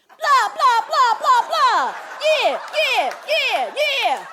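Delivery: loud, high, repeated barks; blustering yells. Retold or imitated. Woman backing down the street, still yelling empty threats. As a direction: keep yelling punchy bluster while retreating. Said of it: "All the while I'm backing up down the street".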